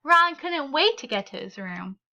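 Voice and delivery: ordinary delivery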